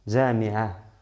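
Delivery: JAMI'AH ending on a small puff of air; sonido de aire final. Shown as a demonstration. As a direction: let air out of the throat to close "jami'ah". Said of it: "Solo un pequeño sonido". ("jami'ah" con un pequeño soplo al final)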